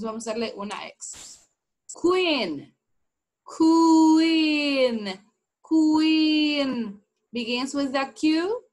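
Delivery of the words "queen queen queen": queen drawn out, loud and high; over-articulated, shouted-out queen; exaggerated queen demo. Shown as a demonstration. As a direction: stretch queen so they see the lips. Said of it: "Miren mi boca".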